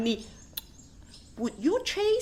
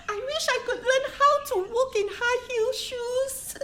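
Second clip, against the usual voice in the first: giggling wish; 9 semitones higher than usual. Acted. Dreaming of being glamorous.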